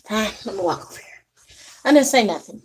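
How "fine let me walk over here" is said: low, very soft aside; retold muttering. Mother walking away after being told off, muttering low. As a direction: grumble it quietly to yourself. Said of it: "I was whispering it".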